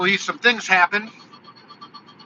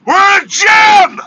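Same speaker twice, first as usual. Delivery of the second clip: high, indignant comeback rising into a yell; exasperated retort exploding at the end; retort building to a shout; 8 semitones higher, 6 dB louder than usual. Retold or imitated.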